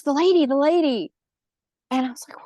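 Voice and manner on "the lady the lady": put-on frightened child. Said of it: "and she goes".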